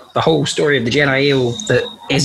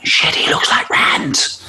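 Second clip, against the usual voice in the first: whispered under the breath; hushed whisper; slower than usual. Acted. Player whispering in shock about Rand, hushed whisper.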